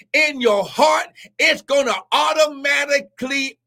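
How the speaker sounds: rising, shouted preaching cadence; preaching at full force. Real, not acted. Preacher rousing the online congregation, in full shouted cadence.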